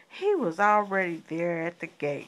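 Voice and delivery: usual speaking voice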